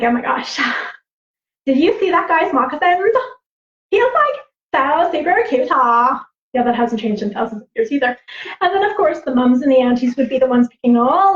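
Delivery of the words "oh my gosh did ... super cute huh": put-on high, excited girly gossip; gushing teenage girl. Teenage girls gossiping about their crushes, gushing. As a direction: imitate a teen gushing over her crush. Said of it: "too busy talking about their crushes".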